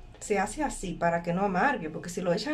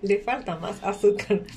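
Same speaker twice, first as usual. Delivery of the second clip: laughing; 4 dB louder than usual. Real, not acted. Tasting the sour juice.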